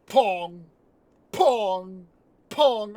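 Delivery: loud, drawn-out comic pong; imitated bonking balls. Film fan mimicking balls bouncing off a head, loud bonks. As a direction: sound out each heavy bonk, loudly.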